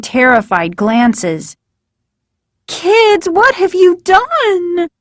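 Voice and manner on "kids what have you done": much higher, frantic mother's cry; a mother horrified at what the kids did; shocked mother crying out. A narrator's reading. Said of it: "Missus Brewer cried".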